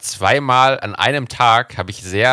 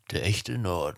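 quiet advertising slogan; beer advert voice; 2 semitones lower, 10 dB quieter than usual. Retold or imitated. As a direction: murmur it like a beer commercial. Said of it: "klingt auch wieder wie aus so einer Bierwerbung".

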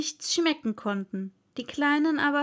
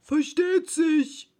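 slow, loud, higher Dodo voice; earnest Dodo; 5 semitones higher, 5 dB louder, slower than usual. A narrator's reading. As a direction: answer slowly and with full importance. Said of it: "entgegnete der Dodo ernst".

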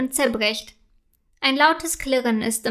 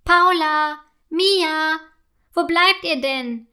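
mother's higher-pitched call; 4 semitones higher, 3 dB louder, slower than usual. A narrator's reading. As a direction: call the children from another room. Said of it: "ruft in dem Moment Paulas Mama". (rufende Mutter, hohe Stimme)

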